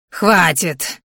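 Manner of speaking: rough, enraged cry; furious yell. A narrator's reading. Verman has had enough of the blows, furious yell. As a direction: put a stop to it in rage. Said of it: "яростно заорал Верман".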